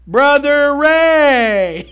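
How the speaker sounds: loud, drawn-out call to Brother Ray; long teasing shout of a name. Real, not acted. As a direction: call his name across the church. Calling out to a congregant.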